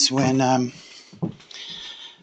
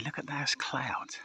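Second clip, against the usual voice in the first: whispering; 2 semitones higher, 14 dB quieter than usual. Real, not acted. Quiet aside about the sky.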